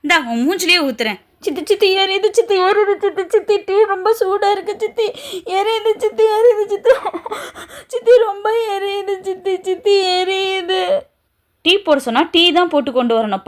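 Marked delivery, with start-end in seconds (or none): 1.4-10.8 high, wailing pain cries; girl burned by hot tea, crying high to her stepmother. Acted. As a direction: wail as the tea burns her. Hot tea thrown on her face.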